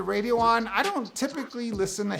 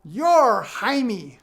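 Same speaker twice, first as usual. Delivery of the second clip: loud, slow, higher correction; drawn-out, emphatic know-it-all denial; mock contradiction; 4 semitones higher, 9 dB louder, much slower than usual. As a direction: imitate a stranger confidently overruling someone. Stranger contradicts his name.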